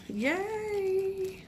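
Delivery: high, drawn-out yay; delighted cheer. Real, not acted. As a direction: stretch out a happy, high-pitched cheer.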